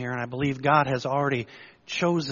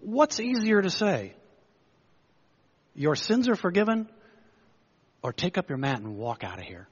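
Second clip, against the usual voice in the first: challenging Jesus; 6 semitones higher than usual. Retold or imitated. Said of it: "he said".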